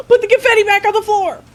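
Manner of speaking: high, loud, quick command; gleeful, rushed shout; excited shouting. Real, not acted. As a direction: yell a giddy order. Celebrating, calling out orders.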